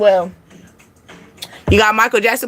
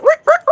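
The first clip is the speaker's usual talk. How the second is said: DJ scratch noise; 3 dB louder than usual. Pretend.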